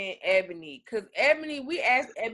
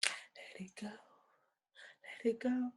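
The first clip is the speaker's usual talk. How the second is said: whispered song; 14 dB quieter, much slower than usual. Pretend. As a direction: hush it out like a secret lullaby.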